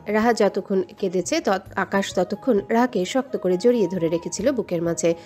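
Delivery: ordinary delivery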